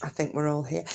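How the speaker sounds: soft, half-whispered mutter; a soft aside under the breath; quiet aside. Real, not acted. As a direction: drop to a hush while checking. Quiet aside while checking chat.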